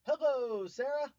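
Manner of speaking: high, loud, bright hello; excited greeting. Real, not acted. Streamer loudly greets viewer Sarah, excited. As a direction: call out to her happily.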